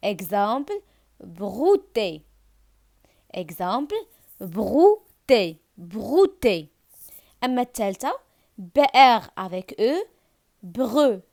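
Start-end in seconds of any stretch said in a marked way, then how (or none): none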